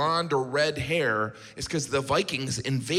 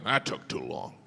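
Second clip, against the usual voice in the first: lower, softer grumbling complaint; grumpy low grumble; 11 semitones lower, 5 dB quieter than usual. Retold or imitated. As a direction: mutter it like a sulky seatmate. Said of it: "was like".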